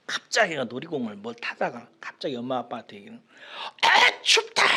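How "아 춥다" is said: put-on very high, loud child's cry; child shouting. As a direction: yell it like a kid whining from cold. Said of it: "막 소리를 지르는 거예요". (어린아이처럼 높게 소리 지르며)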